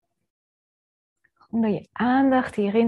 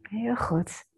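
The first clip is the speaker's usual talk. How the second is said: whispering; 6 dB quieter than usual. Acted. Whispered praise in meditation.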